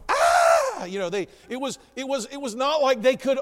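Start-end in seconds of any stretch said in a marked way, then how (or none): 0.1-0.8 sudden high loud scream; terrified scream. Retold or imitated. Body falls out of the closet, audience screams in terror. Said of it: "they were all going".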